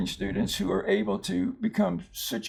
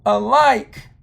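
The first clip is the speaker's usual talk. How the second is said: alike with the stress on the last syllable LIKE, slow; stress on the end; 7 dB louder than usual. Shown as a demonstration. Teacher showing where the accent falls in alike. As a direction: lean on the end of alike, slowly. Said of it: "the accent's here on the end".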